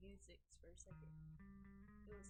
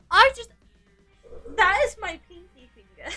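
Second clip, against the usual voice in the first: pained yelp; 4 semitones higher, 10 dB louder than usual. Real, not acted. Hurts finger, cries out laughing.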